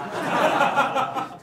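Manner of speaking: loud real laugh; bursting out laughing. Real, not acted. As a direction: crack up. Laughing at own gag.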